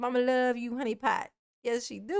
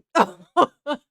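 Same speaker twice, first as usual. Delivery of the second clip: loud laugh; 4 semitones higher, 11 dB louder than usual. Real, not acted.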